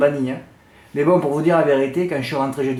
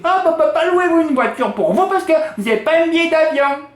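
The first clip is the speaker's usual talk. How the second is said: high-pitched mimicked clerk turning someone away; mock refusal; 10 semitones higher than usual. Rental clerk refusing a customer without plane ticket, mock refusal. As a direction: squawk the refusal, imitating a fussy clerk.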